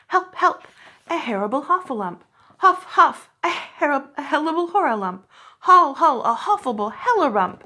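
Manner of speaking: breathless panic. A narrator's reading. Piglet runs, crying in breathless high loud panic. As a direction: panic so hard the words jumble. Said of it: "still crying out".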